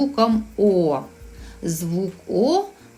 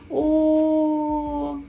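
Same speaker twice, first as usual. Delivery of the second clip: crying moan; 6 semitones higher than usual. A narrator's reading. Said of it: "і вона гірко-гірко заплакала".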